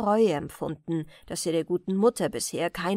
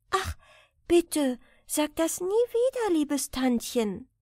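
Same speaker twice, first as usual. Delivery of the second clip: put-on higher, pleading protest of a young girl; little girl protesting; 6 semitones higher than usual. A narrator's reading. Girl protesting to her old aunt, eager and pleading. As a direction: push back eagerly, voice lifting on sag das nie wieder. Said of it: "protestierte dann die Kleine".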